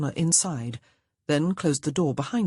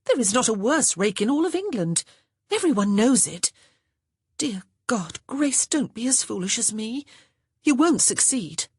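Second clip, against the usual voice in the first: higher-pitched, lightly acted urgent protest; protesting sister; 7 semitones higher, 3 dB louder than usual. A narrator's reading. Honor warning her sister off a rake, mildly urgent protest. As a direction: plead with her against it, pitched up.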